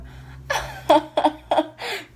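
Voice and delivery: high, loud real laugh breaking out; burst of laughter. Real, not acted. To viewers, after spotting a car: high loud laugh. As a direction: crack up mid-sentence.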